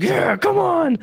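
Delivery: celebrating alone in his room; excited cheer. Retold or imitated. Fan running around his Brooklyn room yelling a high excited cheer. Pumping fists celebrating home run.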